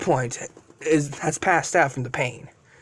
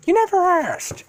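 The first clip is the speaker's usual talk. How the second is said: high-pitched character comeback; retorting dragon; 13 semitones higher than usual. Acted. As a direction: toss back the retort, pitched way up.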